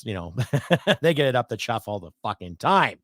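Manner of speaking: normal talking voice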